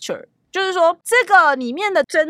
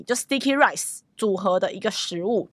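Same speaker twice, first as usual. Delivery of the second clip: ordinary delivery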